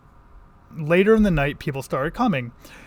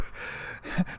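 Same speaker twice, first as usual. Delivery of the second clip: breathy laugh; 10 dB quieter than usual. Real, not acted. Laughing at manager's vague answer.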